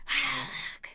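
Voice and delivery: low, loud monstrous groan; pained yell. A narrator's reading. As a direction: roar out in pain, low and breathy. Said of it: "Medusa yelled".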